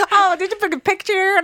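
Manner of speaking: high, teasing country-accent imitation; mock country accent. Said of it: "you had a country accent".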